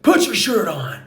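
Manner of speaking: high, loud mock yell across a gym; retold shout. Gym guy yelling at a shirtless lifter, high loud shout. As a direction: bark the order like an angry stranger. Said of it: "he's shouting across the room".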